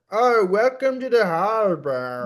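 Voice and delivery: put-on British person botching a Boston accent; hammed-up fake Boston accent; bad Boston accent. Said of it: "British person trying to do a Boston accent".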